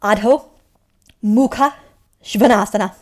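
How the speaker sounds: one word at a time, loud and clear, "Adho... Mukha... Svanasana"; broken into parts. Shown as a demonstration. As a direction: teach "Adho", "Mukha", "Svanasana" separately with a pause after each. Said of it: "Okay, okay, I'll tell you".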